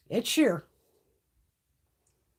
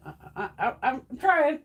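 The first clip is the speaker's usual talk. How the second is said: ordinary delivery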